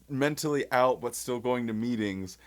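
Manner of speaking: usual speaking voice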